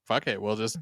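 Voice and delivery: choppy robot voice from a bad connection; glitchy robotic call audio. Real, not acted. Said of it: "you sound like a robot".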